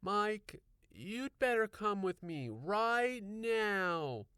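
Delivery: high, slow, firm insistence; insisting. A narrator's reading. Wife insisting her husband come inside, firm slow insistence. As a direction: spell it out slowly, no arguing. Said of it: "she insisted".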